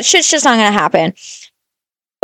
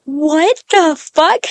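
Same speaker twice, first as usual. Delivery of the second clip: slow, drawn-out stunned disbelief; stunned slow what the fuck; 5 semitones higher, much slower than usual. Retold or imitated. Friends hearing kids talk of killing moms, slow stunned disbelief. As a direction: let it hang in shock. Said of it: "we were like".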